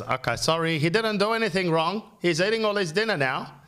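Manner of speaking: put-on high child voice, protesting; high-voiced kid making excuses; voice of daughter. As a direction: imitate a little girl backtracking, pitched high. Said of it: "she goes".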